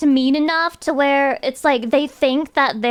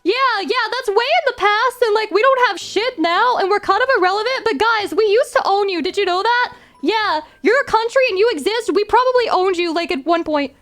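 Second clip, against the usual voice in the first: high, smug mock British accent, lecturing the guys; a hammed-up British colonizer bragging; 6 semitones higher than usual.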